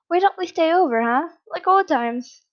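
high, loud, playfully innocent; innocent suggestion. A narrator's reading. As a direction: suggest it brightly, all innocence. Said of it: "smiled innocently at his son".

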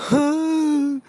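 put-on high wailing toddler cry. Toddler wailing over the die during the game. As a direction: whine like a toddler denied a toy.

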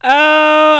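long, loud, high "oh"; a big wailing oh to let it out; overcome groan. Real, not acted. Podcaster needing to get it together, loud high wail. Emotional outburst before composing herself.

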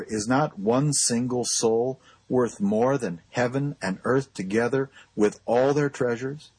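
usual speaking voice

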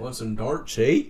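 mock Canadian accent, casual; jokey Canadian accent offering darts; a light Canadian accent with a drawn-out eh. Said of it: "That was a Canadian accent".

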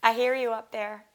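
high, heartfelt thanks upward; thanking her late dog in heaven, high and moved; grateful to the sky. Retold or imitated. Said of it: "And I was like".

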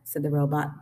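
usual speaking voice

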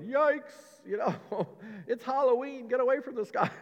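high, loud mock yikes; shy away from the scary man, hammed-up; mock fright. Townsfolk recoiling from the possessed man, in high mock fright. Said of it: "Everybody else was probably going".

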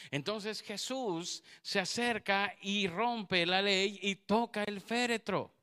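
full-force preaching. Acted. Preacher to the women in church, full-force preaching. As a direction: proclaim it from the pulpit.

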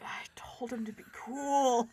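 whispering, slow and laughing; hushed director aside; whispered director aside. Retold or imitated. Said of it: "the director is like".

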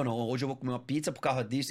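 usual speaking voice

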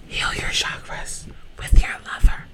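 breathy whispered read; ASMR whisper. Pretend. ASMR reading.

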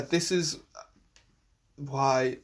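strained mid-stretch; talking through a stretch; stretching groan. Real, not acted. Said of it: "sorry, I'm just stretching here".

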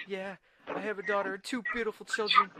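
high put-on cartoon voice; acted frightened customer. Customer telling SpongeBob of family, high acted voice. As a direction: answer in a high, pleading mock voice.

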